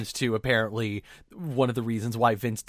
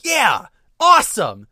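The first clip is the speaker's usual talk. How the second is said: thrilled fan; 7 dB louder than usual. Retold or imitated. Co-host voicing a fan's delight, excited. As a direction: gush like a fan who loves him.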